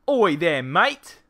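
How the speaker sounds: loud, hammed-up Australian greeting; Australian accent. Player greeting Jenny in a hammed-up Australian accent. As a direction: bellow it like a cheery Australian bloke. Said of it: "That's Australian".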